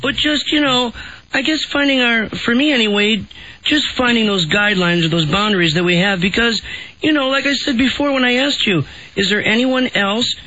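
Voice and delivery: high whiny woman voice; Amber Fry voice. Acted. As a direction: whine it out like a clingy girlfriend. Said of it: "way too good at the whiny woman voice".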